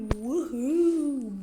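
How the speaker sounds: swooping woohoo; playful whoop. Real, not acted. Gamer cheers the car's bass, playful whoop.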